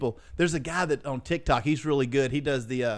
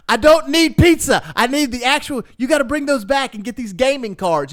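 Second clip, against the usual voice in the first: high, loud mock exasperation; exasperated scolding; 9 semitones higher, 7 dB louder than usual. Scammer sending man back for other cards, exasperated. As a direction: imitate a fed-up scammer laying down orders.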